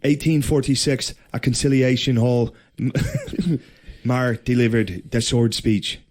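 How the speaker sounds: put-on Irish-accent read of the history notes; mock Irish accent reading. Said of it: "I can read it".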